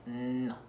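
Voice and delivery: No with a long humming N, tongue on the palate; "No", opened on a sustained N; held N. Shown as a demonstration. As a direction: stretch the N in No. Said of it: "Tiene que estar la lengua rozando el".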